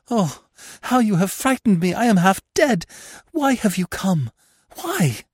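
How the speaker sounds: half dead with fear, pleading why; overwhelmed fright. A narrator's reading. Anna to Gurov on the staircase, high panicked fright. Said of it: "breathing hard, still pale and overwhelmed".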